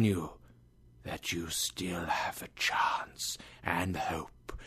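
a ghost breathing out his warning; hushed, hollow spirit voice; ghostly whisper. Acted.

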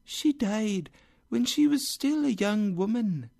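high, floating otherworldly voice; ghostly spirit. Acted. Spirit tells Scrooge of his sister's death, ghostly. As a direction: let it drift high and gliding.

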